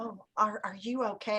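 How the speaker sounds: soft, hesitant, unsmiling question; dead serious. Retold or imitated. Unsmiling woman asks about her fall, dead serious. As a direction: imitate her quiet, earnest checking. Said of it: "so serious, she never smiled".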